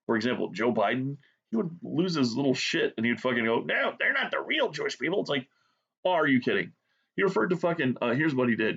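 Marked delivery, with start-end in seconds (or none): 3.6-5.2 high-pitched mocking imitation of a protest; mock outrage in a raised voice; indignant pundit. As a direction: mimic a whiny objection, pitched up. Mimics Shapiro's indignant protest.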